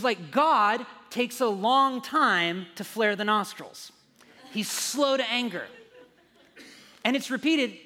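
loud, high, driving preacher voice; preaching at full force. Acted. Pastor preaching hard to the congregation, loud and driving. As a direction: build the sermon to full force.

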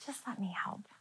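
soft whispered invitation; gentle whisper. Retold or imitated. Jesus gently inviting the weary, soft and whispered. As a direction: breathe it tenderly, barely voiced. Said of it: "And he's like".

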